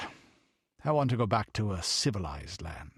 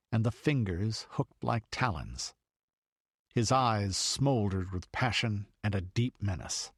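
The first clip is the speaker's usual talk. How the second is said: ordinary delivery, nothing marked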